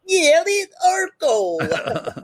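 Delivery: very high, loud cartoon voice; hammed-up, high-pitched character impression; Orko. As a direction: squeal it like a little cartoon creature. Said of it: "sounds a little bit like Orko".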